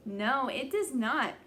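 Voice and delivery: usual speaking voice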